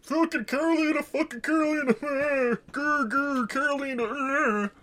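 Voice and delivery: high, strained cartoon growling; mock grumbling. Mocking the date's obsessive rant about Carolina, high growly grumble. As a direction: grumble and growl like a caricature sore loser.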